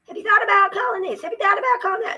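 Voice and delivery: high, fast mocking singsong; mocking the helpers. Mocking the agencies' advice in a high fast voice. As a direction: parrot them in a high fast voice.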